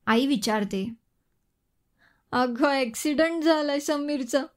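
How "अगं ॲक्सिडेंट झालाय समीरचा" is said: crying. A narrator's reading. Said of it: "ती रडतच म्हणाली".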